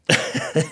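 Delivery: quick burst of a laugh, high and loud; short laugh. Real, not acted. Hearing the world-class prediction, a sudden loud laugh. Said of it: "I, I laughed".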